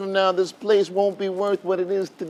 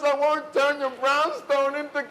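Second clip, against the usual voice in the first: begging; 7 semitones higher than usual. Acted. Begging grandmother not to punish.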